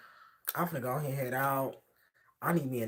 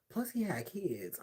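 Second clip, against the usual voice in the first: whisper; 4 semitones higher, 5 dB quieter, slower than usual. Real, not acted. Aside whisper about the man's kids.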